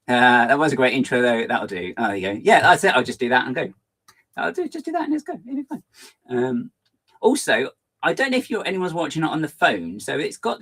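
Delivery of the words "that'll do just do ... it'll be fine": mock breezy. Imitating casual attitude.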